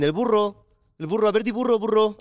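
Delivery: high, sing-song schoolyard teasing; mocking taunt. Cousins mocking the boy at school, high teasing. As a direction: needle a little cousin who can't say his R's. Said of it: "me decían".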